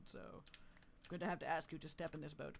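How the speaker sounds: normal talking voice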